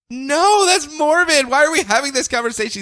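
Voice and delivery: high, fast protesting voice; mock her horrified protest; upset Lana. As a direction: Lana cutting him off, high and quick. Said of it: "she's like".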